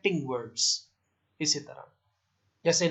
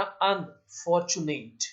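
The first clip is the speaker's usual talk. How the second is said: Unfortunate slowly, with a gap after अन; split into parts; much slower than usual. Shown as a demonstration. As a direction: break unfortunate into अन and fortunate. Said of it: "splitting syllable".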